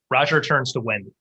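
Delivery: usual speaking voice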